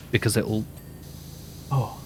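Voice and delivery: hiccups breaking the words; hiccuping. Real, not acted. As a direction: talk through a bout of hiccups. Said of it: "these hiccups are really killing me".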